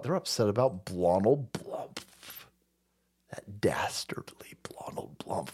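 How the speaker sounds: hushed sinister mockery; mock villain whisper. Mocking the left.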